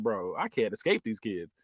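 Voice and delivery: low, soft mock exasperation; understated retold annoyance; pissed off, retold. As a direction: grumble it low, lightly imitating a fed-up man. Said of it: "so he's pissed off".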